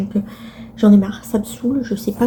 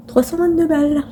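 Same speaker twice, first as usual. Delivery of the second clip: exasperated; 6 semitones higher than usual. Real, not acted. Repeats the price in dismay.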